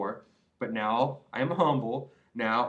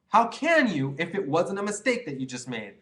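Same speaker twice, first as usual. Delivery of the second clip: ordinary talk, nothing marked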